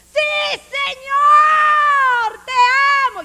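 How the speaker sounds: hammed-up shouting of a loud worshipper; mock worship shout. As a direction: bellow the prayer like a show-off worshipper. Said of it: "No tenés por qué gritar tanto así".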